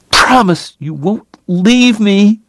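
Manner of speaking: high, slow plea; tearful pleading. A narrator's reading. Sobbing woman to her dying lover, pleading. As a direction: plead for a promise, pitched high.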